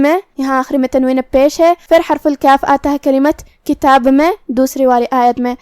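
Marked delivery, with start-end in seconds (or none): none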